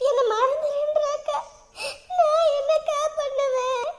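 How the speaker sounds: slow, tearful lament. Acted. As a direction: wail helplessly to your sister, drawn out. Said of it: "நீ அழுகாத". (அழுதபடி புலம்பலாக)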